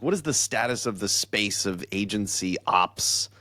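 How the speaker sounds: usual speaking voice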